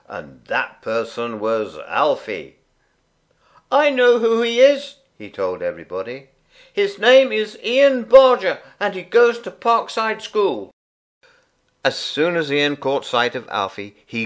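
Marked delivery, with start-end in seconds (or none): 3.7-5.0 put-on high, loud small-boy voice; a little boy telling the grown-ups; little Alfie speaking up. A narrator's reading. Alfie announcing to everybody. 6.7-10.7 put-on high, loud small-boy voice; a little boy telling the grown-ups; little Alfie speaking up. A narrator's reading. Alfie announcing to everybody.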